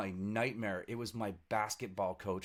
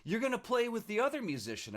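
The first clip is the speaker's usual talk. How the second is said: party planner voice pitched way up; lightly voiced planner, raised high; mock party planner; 12 semitones higher than usual. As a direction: imitate the pushy planner, much higher. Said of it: "and she's like".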